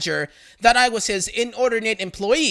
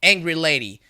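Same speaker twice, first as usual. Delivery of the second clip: usual speaking voice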